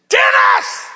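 high, explosive scream; loud re-enacted scream; screaming back. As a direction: scream the name to cut through a rant. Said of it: "And I screamed back".

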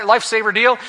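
normal talking voice

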